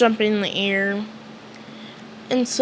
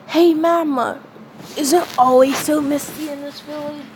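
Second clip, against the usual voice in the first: put-on higher boyish voice, changed tone; Asta voice; 4 semitones higher than usual. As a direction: ask it up high like a curious kid. Asta asking Magma about mist.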